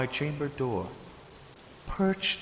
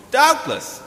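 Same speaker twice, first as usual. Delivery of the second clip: much higher and louder sudden word; startled outburst; 9 dB louder than usual. Acted. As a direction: jump at the thought. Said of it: "said I".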